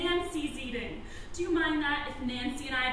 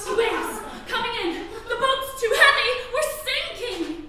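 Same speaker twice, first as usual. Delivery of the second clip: high-pitched alarm at danger; urgent panic; 6 semitones higher than usual. Pretend. Woman playing out the sinking boat for husband, panicked. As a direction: raise the pitch as the boat founders.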